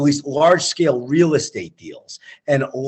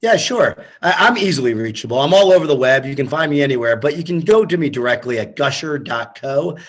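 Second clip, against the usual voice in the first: ordinary talk, nothing marked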